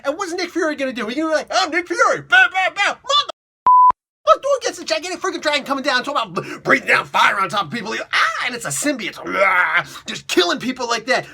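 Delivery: high-pitched, loud, hammed-up panic; hyper ranting. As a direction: rant in mock disbelief, bursting into shouts. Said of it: "getting like, really hyper".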